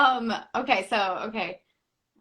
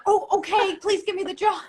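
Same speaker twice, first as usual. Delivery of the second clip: much higher, bright pleading rush; eager; 11 semitones higher, 4 dB louder than usual. Retold or imitated. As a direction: beg for the job with giddy eagerness. Eager to book the weird role.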